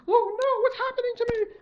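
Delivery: put-on high, loud panicked girl's cry; frightened cry. Girl lifting off into the sky, high frightened cry. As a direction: squeal it out as she floats off in fright. Girl starts floating away.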